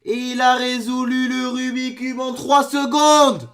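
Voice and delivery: slow, high, loud stretched cry; amazed wail. Real, not acted. Reacting to a three-second solve, amazed wail. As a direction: drag every syllable out in high disbelief.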